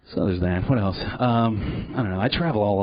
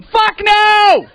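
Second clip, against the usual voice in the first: much higher, loud shriek; screaming monkey; 11 dB louder than usual. Pretend. Monkey in the headlights screaming. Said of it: "you're gonna catch in your headlights going".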